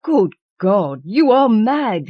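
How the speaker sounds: high, loud, slow horrified cry; horrified husband crying out at his wife; aghast cry. A narrator's reading. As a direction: recoil in horror as you cry out. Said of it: "he cried aghast".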